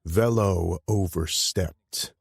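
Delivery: slow, deliberate, measured words; slowly. Acted. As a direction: lay each word down slowly. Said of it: "I said slowly".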